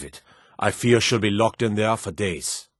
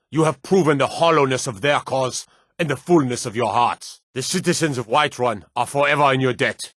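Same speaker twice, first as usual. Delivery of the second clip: raised, ringing commander's address; rousing speech; 4 semitones higher than usual. Acted. Commander addressing his soldiers after victory, rousing and proud. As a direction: lift the men's spirits, proud and stirring.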